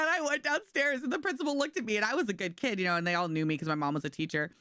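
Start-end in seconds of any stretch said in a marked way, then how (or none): none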